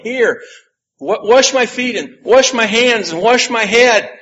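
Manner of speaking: excited overeager plea; eager rush. Retold or imitated. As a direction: blurt it out, wanting everything washed. Peter suddenly eager for washing.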